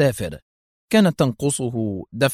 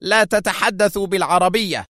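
nervous shout; 8 semitones higher than usual. Acted. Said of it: "صاح لوزيسكي في عصبية".

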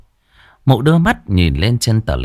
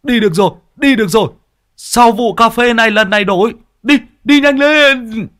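high, loud elated cry; husband thrilled the bike finally starts; overjoyed relief; 14 semitones higher, 4 dB louder than usual. Acted. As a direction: shout with relief as the engine catches. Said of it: "chú mừng như bắt được vàng".

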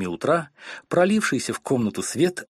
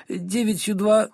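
tearful recital; 10 semitones higher, slower than usual. Acted. Frightened wife recites tables.

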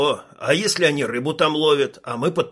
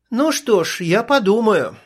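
teasing, a touch higher and louder; playful; 7 semitones higher, 4 dB louder than usual. A narrator's reading. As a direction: toy with him a little, playfully. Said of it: "игриво отозвалась Стрелка".

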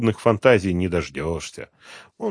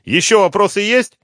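high, loud defiant question; defiant challenge; 14 semitones higher, 10 dB louder than usual. Acted. Woman dares the detective to go on, defiant. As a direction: dare him to ask more.

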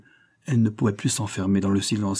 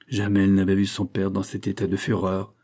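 usual speaking voice

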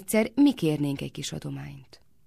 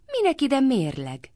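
higher, louder and slow in annoyance; angry question; 9 semitones higher, 5 dB louder than usual. A narrator's reading. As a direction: snap it out, indignant. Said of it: "kérdezte mérgesen Lalita". (mérgesen, magasan és hangosan)

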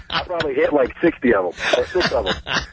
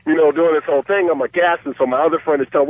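ordinary delivery

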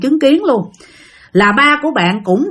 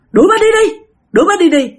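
high, louder retold order; insistent refusal; 10 semitones higher, 4 dB louder, faster than usual. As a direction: act out the husband demanding she be sent away. Husband refuses, insists again. (giọng chồng gắt, khăng khăng đuổi)

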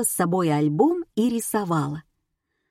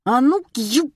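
loud, higher-pitched sudden burst; blurted outburst; 6 semitones higher, 5 dB louder than usual. A narrator's reading. Spiridonova spots Oksana, blurting an expletive. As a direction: blurt out a curse. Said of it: "выпалила Спиридонова".

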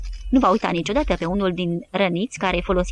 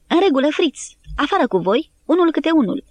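higher, louder order from outside; a soldier barking at the surrenders; victor's command; 8 semitones higher, 4 dB louder than usual. A narrator's reading. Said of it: "o voce spunând". (ordin mai înalt și mai tare, de învingător)

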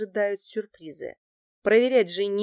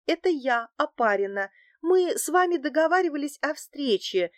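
higher-pitched, put-on nervousness; flustered nervousness; 7 semitones higher, 3 dB louder than usual. A narrator's reading. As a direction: act a little flustered, voice raised in pitch. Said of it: "сбивчиво заговорила я, демонстрируя волнение". (слегка сбивчиво, с наигранным волнением)

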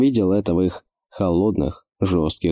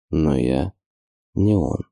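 gloomy; 3 semitones lower, slower than usual. Acted. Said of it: "хмуро подумал орк".

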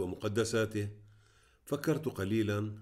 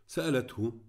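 plain reading voice

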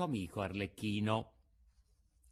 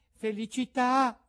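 Pinocchio's voice; 13 semitones higher, 7 dB louder than usual. A narrator's reading. Pinocchio blesses the sneeze.

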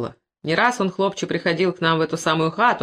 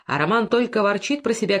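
ordinary delivery

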